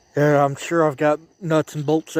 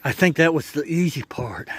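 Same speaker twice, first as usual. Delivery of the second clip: normal talking voice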